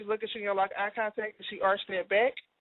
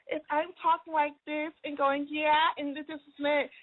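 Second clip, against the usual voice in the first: put-on higher, annoying little-kid voice; baby voice; 5 semitones higher than usual. Shown as a demonstration. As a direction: put on a five-year-old's baby talk. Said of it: "reminds me of a freaking annoying five-year-old".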